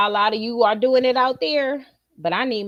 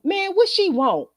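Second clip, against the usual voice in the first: higher, lightly voiced teen grumble; annoyed teen son; 7 semitones higher than usual. Mother imitating her son annoyed at being called, higher. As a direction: grumble like a put-out teenager. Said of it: "I hear my son go".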